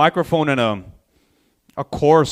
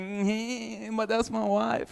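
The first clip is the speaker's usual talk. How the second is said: small, high, soft voice; mock meek husband, quiet and higher; weak husband; 5 semitones higher, 11 dB quieter, slower than usual. Weak-looking husband watching his wife fight, meekly. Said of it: "like, a weak-looking guy".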